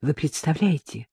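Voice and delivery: soft, slow, hushed rapture; dreamy. A narrator's reading. Marishka imagining a metro wedding, dreamily. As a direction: breathe it out as a daydream. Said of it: "мечтала Маришка".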